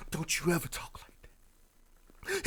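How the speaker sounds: slow, hammed-up whispered scolding; mock intense whisper. Mocking a boyfriend reassuring his woman, intense whisper. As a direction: whisper it with overdone devotion, dragging the words. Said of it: "He's supposed to say".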